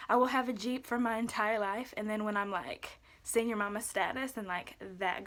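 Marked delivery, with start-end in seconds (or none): none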